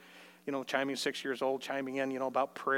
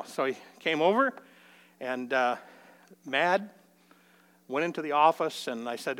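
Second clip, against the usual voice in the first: ordinary delivery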